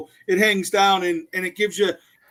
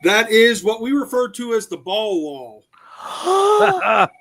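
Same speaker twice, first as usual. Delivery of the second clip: slow punchline; 5 dB louder, much slower than usual. Acted.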